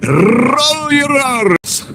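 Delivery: very high, slow, strained "roll your R's" with the R rolled; mock rolled R's. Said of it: "You have to".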